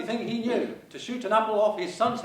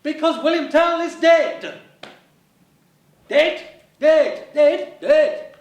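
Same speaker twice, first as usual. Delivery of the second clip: louder and higher, slow on the news; loud comic declaration; 4 semitones higher, 6 dB louder, slower than usual. Acted. Clown announcing to partner loudly, hammed-up.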